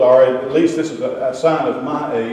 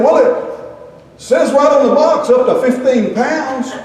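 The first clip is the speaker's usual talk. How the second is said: slow, laughing excuse from the dad; mock-innocent dad reading the diaper box; clueless husband; 8 semitones higher, 4 dB louder, slower than usual. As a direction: play the dopey husband defending himself. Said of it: "and he said".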